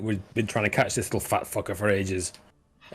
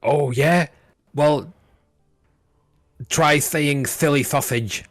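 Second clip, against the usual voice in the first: higher, loud, slow lisping challenge; guard with a lisp; 6 semitones higher, 5 dB louder, slower than usual. Acted. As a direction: dare them with a lisp.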